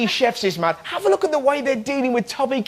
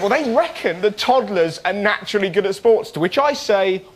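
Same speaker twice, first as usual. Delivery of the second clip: ordinary delivery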